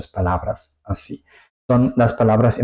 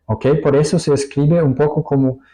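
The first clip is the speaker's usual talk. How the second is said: usual speaking voice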